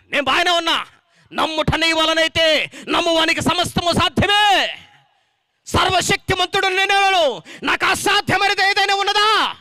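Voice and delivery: loud, rising sermon cry; fiery preaching. Acted. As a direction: proclaim it to the whole hall. Preaching at full force. (బిగ్గరగా ఉద్రేకంతో బోధించడం)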